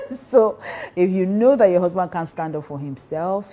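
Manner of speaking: usual speaking voice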